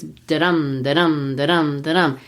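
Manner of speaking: steady "da-dum", weak then strong; iambic beat. Shown as a demonstration. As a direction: lean on "dum" in every "da-dum". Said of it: "it would be this".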